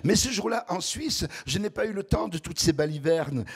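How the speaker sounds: ordinary talk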